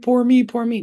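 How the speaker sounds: mock their self-pity in a whiny wail; mock whiny victim. Mocking friends who text him self-pity, whiny wail.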